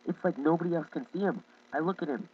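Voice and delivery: ordinary delivery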